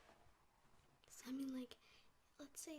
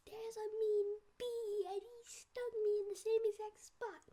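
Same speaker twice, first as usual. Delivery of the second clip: whiny little sister; 11 semitones higher, 14 dB louder than usual. Retold or imitated. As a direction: wail it like a wronged little sister. Sister complaining about bee sting.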